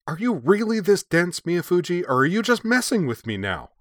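high-pitched, exasperated outburst; red-faced, high-voiced indignant demand. A narrator's reading. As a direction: demand an answer, worked up and incredulous. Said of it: "Her face going red ... demanding".

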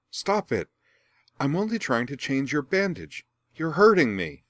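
high, loud cry of protest; pained protest. Acted. Rian protests while Azula grips her arm, high and loud. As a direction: plead loudly while being hurt. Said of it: "her friend's protest".

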